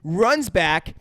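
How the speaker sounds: ordinary delivery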